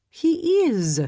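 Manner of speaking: high indignant French protest; indignant French accent. A narrator's reading. Lizette insisting her husband is gentle, indignant French accent. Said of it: "Her voice carried a lilting French accent".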